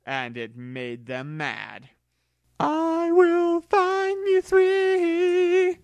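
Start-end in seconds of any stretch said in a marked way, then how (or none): 2.6-5.8 very slow, high and loud creature vow; Mewtwo voice, vowing. Acted. Mewtwo vows to find Mew three, high, slow and loud. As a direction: draw each word out like a grand oath.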